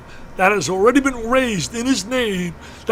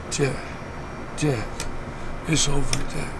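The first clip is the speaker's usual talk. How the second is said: low, faint imitation of a failing friend; barely audible, weak; 6 semitones lower, 6 dB quieter than usual. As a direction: retell it in a feeble, halting voice, with long gaps. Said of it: "I barely could hear him".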